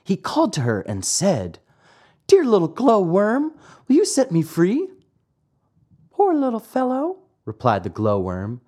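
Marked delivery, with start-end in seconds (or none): 2.3-4.9 high-voiced puppet boy, pleading; Pinocchio pleading. Trapped puppet begs a glowworm for help, high pleading. 6.1-7.2 very high little glowworm voice; pitying glowworm. Acted. Glowworm to the trapped puppet, high and pitying. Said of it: "stopping to look at him with pity".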